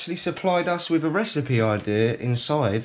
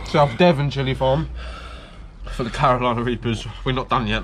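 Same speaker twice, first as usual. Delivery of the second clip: ordinary talk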